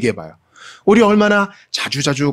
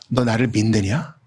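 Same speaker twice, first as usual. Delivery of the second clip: gentle voice of God; 5 semitones lower, 4 dB quieter, slower than usual. Retold or imitated. God asks the doubter.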